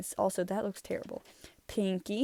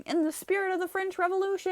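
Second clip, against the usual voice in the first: Funtime Freddy; 11 semitones higher, faster than usual. Acted. Showing a drawing of Funtime Freddy going into character, high proclamation. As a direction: exclaim it high like an animatronic character. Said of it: "this is Funtime Freddy going".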